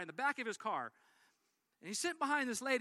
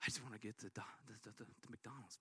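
much lower, softer whiny grumble; exasperated driver; 11 semitones lower, 13 dB quieter, faster than usual. Retold or imitated. Preacher voicing the pulled-over driver, low and whiny. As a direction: groan it low like a fed-up driver. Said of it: "And he's like".

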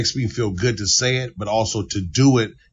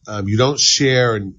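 usual speaking voice

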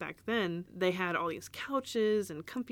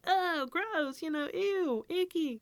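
much higher, mock-grossed-out toddler; imitated disgust; 11 semitones higher, slower than usual. Imagined toddler tasting mom's cappuccino, mock disgust. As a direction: recoil in a high-pitched, playful ew. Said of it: "take a sip and be like".

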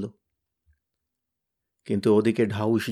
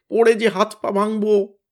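high, louder frightened whine; whining; 7 semitones higher, 4 dB louder than usual. Acted. Tenida whines down from the sky, whining. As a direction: whimper it out in fear. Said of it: "Tenida কাউঁ কাউঁ করে বললে".

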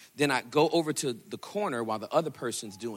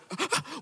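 startled gasp. Retold or imitated. Cashier startled by payment.

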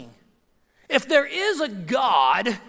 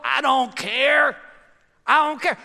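defiant shout; 4 semitones higher, 5 dB louder than usual. Acted. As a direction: bellow it like a man who won't budge. Preacher voicing indifferent man.

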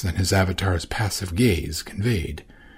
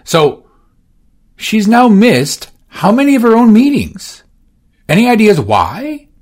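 high, loud irritated questioning; exasperated Orsino; 13 semitones higher, 8 dB louder than usual. Acted. Orsino irritably questioning his assistant. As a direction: press for an answer.

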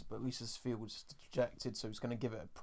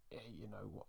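whispered aside; 2 semitones lower, 13 dB quieter than usual. Real, not acted. Unvoiced aside.

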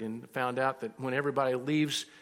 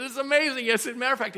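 higher and louder, gushing it's amazing; excited; 11 semitones higher, 9 dB louder than usual. Retold or imitated. Pastor gushing to the customer about Cincinnati, higher and louder. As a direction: pour on the enthusiasm.

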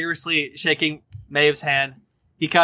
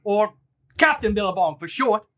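high Australian sea captain introducing himself; 6 semitones higher than usual. Acted. Captain introducing himself in an Australian accent. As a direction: give your name in a broad Australian accent. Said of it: "that's how you do a fucking Australian accent".

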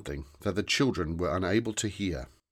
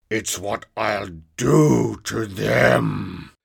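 slow, loud, dragged-out monster voice; a small green monster spelling out the accusation slowly; menacing monster; 2 semitones higher, 8 dB louder, much slower than usual. Acted. Grobble threatens.

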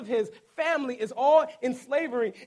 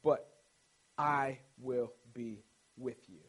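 slow, soft and lower, reassuring promise; calm God; 10 semitones lower, 5 dB quieter, slower than usual. Shown as a demonstration. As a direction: speak it gently as a steady promise. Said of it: "This is how God responds".

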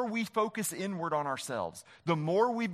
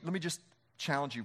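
ordinary delivery

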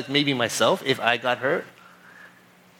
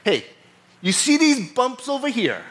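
imitated frustrated officer, pitch raised; upset policeman; 8 semitones higher than usual. Frustrated officer pointing out bumps to driver, imitated upset. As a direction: retell the officer's exasperated scolding in a higher voice. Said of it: "he got upset at me. He was frustrated".

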